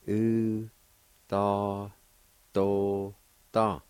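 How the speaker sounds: each syllable said apart with a pause. Shown as a demonstration. Teacher sounding out Cherokee syllables, one at a time. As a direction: break the word into separate syllables. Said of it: "First part is pronounced like this".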